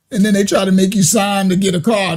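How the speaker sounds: usual speaking voice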